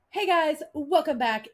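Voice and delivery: put-on high bright kid vlogger greeting; chirpy child YouTuber intro; little girl voice. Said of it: "she goes".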